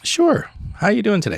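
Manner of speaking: high, sing-song welcome; very cheery host. Retold or imitated. Host seats a cranky couple, sing-song cheerful welcome. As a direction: beam the welcome at them.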